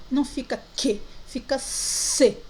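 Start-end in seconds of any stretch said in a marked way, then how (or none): 1.2-2.4 C said like s, drawn out; sound of C before E. Shown as a demonstration. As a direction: stretch the "C" into a clear ss sound. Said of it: "junta o C com E".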